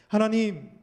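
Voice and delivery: crying out in prayer, pleading and loud; anguished cry to God. Acted. Hannah cries out in prayer.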